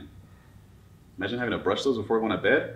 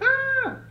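very high, held squeal; tiny high-pitched scream of fright; frightened squeal. Acted. Scared little Sam facing the monster, high squeal. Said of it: "And Sam's like".

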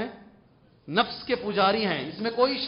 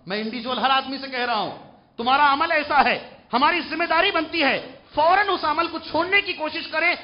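raised preaching voice, pressing hard; forceful exhortation; 8 semitones higher, 7 dB louder than usual. Acted. Preacher asking young men for a promise, forceful exhortation. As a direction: demand a promise from the crowd.

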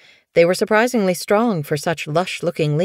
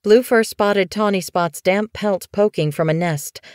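plain reading voice

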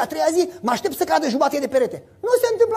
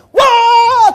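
loud high whoop of joy; victory shout; 12 semitones higher, 13 dB louder than usual. Shown as a demonstration. Preacher demonstrates the shout of victory to the congregation, loud whoop. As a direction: let out a joyful victory cry. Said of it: "Să strige de bucurie".

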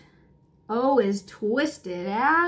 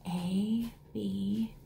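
whisper; 3 semitones lower, 8 dB quieter than usual. Acted. Letters named in hushed voice.

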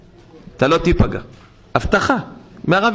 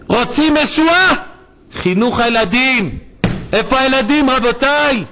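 fiery exhortation; 7 semitones higher than usual. Real, not acted. Rabbi exhorting the crowd.

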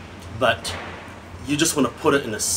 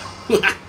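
quick breathy chuckle; a burst of laughter at his own line; short laugh; 7 semitones higher than usual. Real, not acted. Reviewer laughing at his gimp leather note, breathy chuckle.

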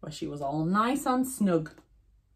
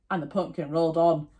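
ordinary delivery, nothing marked

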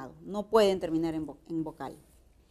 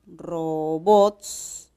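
"robots" drawn out as RO-BOTS; slow syllable-splitting demo of "robots"; 3 semitones lower, 4 dB louder than usual. Shown as a demonstration. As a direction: stretch "robots" slowly in two syllables. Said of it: "de silabeo".

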